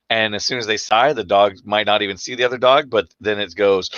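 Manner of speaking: ordinary talk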